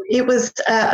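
ordinary talk, nothing marked

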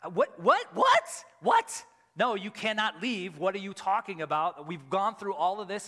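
mock-furious what what what, then pleading; angry outburst. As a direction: replay the furious disbelief, shouting. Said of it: "And then I was angry".

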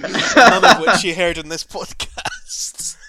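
loud high slow laughing line. Real, not acted. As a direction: laugh while saying it. Laughing wrap-up.

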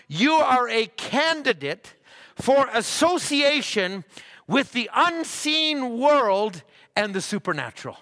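high, forceful sermon voice with punched key words; fervent preaching. Acted. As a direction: preach it hard, stretching the big words.

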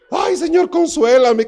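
high, loud hammed-up plea; mock whiny prayer. Mocking the jilted girl praying, high and whining. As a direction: overdo a self-pitying plea to God.

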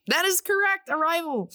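high, bright announcer call; excited game-show confirmation. Acted. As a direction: belt it out like a quiz host. Host confirms a right answer.

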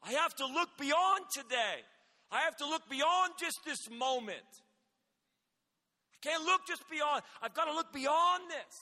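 big revival-style proclamation; full-force preaching. Acted. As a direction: preach it out to the crowd.

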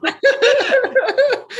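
squeaky real laugh, high; high burst of laughter. Real, not acted. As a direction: laugh out at the silly threat. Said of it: "laughs at own line".

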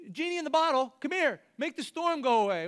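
high, demanding genie call; mock summons. Disciples ordering Jesus around like a genie, mocking.

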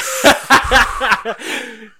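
high, loud burst of laughter. Real, not acted. Big laugh at joke.

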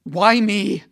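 loud, high anguished cry; furious despairing shout; raging. A narrator's reading. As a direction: howl it at the sky. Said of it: "I rage".

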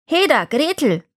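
high, swooping witch's summons; calling out. A narrator's reading. Witch summons the girl, high call. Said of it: "rief sie dem Mädchen zu".

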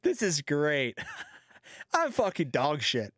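smug chuckling self-mockery; a laughing, unbothered voice; laughing umpire. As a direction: play the umpire amused at his own failure. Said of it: "He's like".

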